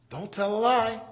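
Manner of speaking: ordinary talk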